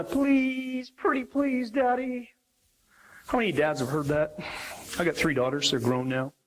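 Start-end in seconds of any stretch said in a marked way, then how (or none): 0.0-2.3 high, drawn-out pretty-please; high sing-song begging of a child; pleading little daughter. Acted. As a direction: wheedle Daddy like a little girl. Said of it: "And then she puts on the".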